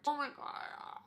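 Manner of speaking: whispered high exclamation; breathless whisper. Real, not acted. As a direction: gasp it under your breath. Describing being ripped apart.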